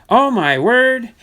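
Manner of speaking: high, loud, drawn-out oh my word; excited gasp. Real, not acted. Collector spotting a star card, excited cry. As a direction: let the surprise burst out over the card. Pulls a near-Ripken card.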